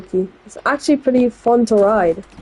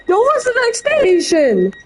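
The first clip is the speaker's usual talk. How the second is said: voice crack; 10 semitones higher than usual. Real, not acted. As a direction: voice cracking up high. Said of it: "Holy voice crack".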